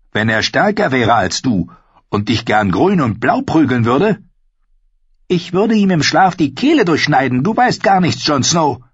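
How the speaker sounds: put-on high, bold young wildling woman; spirited young woman, teasing; Ygritte voice. Narrator voicing Ygritte.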